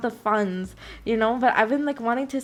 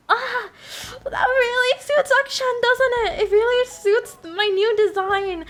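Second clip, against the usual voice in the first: excited; 11 semitones higher, 3 dB louder than usual. Retold or imitated. Excited reaction to the art.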